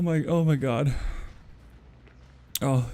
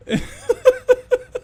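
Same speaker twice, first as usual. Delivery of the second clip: loud burst of laughter; 5 dB louder than usual. Real, not acted. Streamer cracking up at game.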